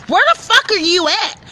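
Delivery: high, loud, furious phone yell; retold angry caller shouting; angry demand. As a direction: bark it down the phone at her. Angry phone call from the girl.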